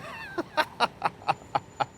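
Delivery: high burst of laughter; short laugh. Real, not acted. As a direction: laugh at your own joke. Laughs at own joke.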